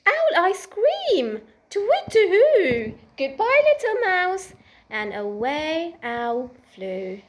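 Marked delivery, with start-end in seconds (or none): 0.1-4.5 high, loud hooting owl in alarm; frightened owl. Acted. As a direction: hoot it out and flap away. Owl flees the gruffalo.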